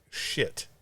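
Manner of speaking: a breathless, hushed curse under his breath; whispered curse. Retold or imitated. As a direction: mutter it so the cop can't hear. Reacting to expired tags.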